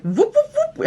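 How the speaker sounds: high-pitched cartoon "whoop, whoop, whoop"; cartoon sound effect. Storyteller mimics cartoon sound effects of her fall, whooping high. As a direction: imitate a slip with high rising whoops. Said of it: "I'm sure there were sound effects".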